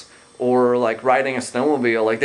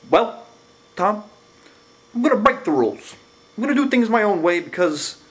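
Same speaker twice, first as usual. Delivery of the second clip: much higher, excited mock Obama; imitating Obama; 9 semitones higher than usual. Mocking Obama answering the moderator, much higher and excited. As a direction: ham up a candidate breaking the rules. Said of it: "And then Barack Obama says".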